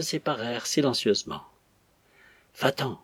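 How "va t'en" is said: plain reading voice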